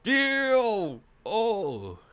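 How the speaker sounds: high, loud drawn-out cry; big stunned holler; shocked exclamation. Real, not acted. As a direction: wail it out long, then drop. Streamer reacting to the scene.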